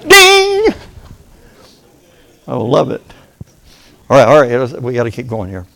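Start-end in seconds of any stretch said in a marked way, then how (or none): 0.1-0.7 very high bright bell sound, sung out; imitated heaven bell ding. Shown as a demonstration. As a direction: ring it out like a bell going off in heaven. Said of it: "watch, it goes like this".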